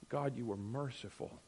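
soft, low awed prayer; whisper-soft recognition of mercy; hushed realization. Retold or imitated. Believer realizing at the end, praying quietly in awe.

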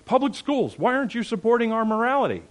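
high rushed mock complaint; mocking whiny complainers. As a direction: parody panicked parents pleading with schools. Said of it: "We're running and going".

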